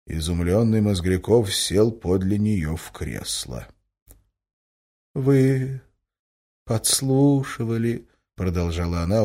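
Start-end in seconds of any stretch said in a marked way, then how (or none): 5.1-8.1 slow, raised-pitch reproach; reproachful. A narrator's reading. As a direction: accuse him gently, word by word. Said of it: "укоризненно глядя ему в лицо". (укоризненно, протяжно)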